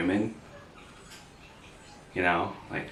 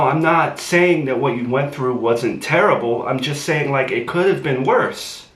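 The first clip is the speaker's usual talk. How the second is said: high, loud, fast frustrated yelling; exasperated shouting; 7 semitones higher, 6 dB louder than usual. Acted. Boyfriend yelling at Gabby after she tells him to leave, loud and fast. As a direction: shout him down, fed up and defensive.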